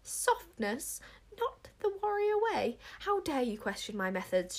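rising, incredulous indignation; an offended monarch freezing the room, pitch climbing; icy outrage. A narrator's reading. Queen answering the Witchmeller's insult, icy outrage. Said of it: "in a voice that could have frozen".

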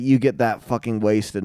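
ordinary delivery

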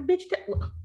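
snapping at neighbor. Retold or imitated. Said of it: "I'm like".

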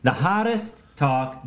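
announcer voice. Acted. Announcer intro.

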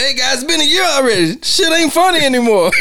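high, fast indignant complaint; imitated upset outburst. Murtaugh complaining to guys at the office, upset. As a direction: protest in a high, fed-up mock voice. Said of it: "you can tell he's upset".

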